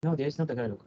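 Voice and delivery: usual speaking voice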